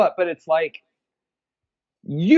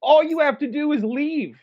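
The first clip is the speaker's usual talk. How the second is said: higher mock pitch to buy; developers' offer; 9 semitones higher than usual. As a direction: play the pushy buyer. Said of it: "they're just like".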